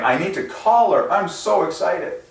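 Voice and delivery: high, bubbly and thrilled; high-pitched happy gush; gushing excitement. Pretend. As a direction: play her as a giddy fan who can't wait to phone. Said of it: "she's like".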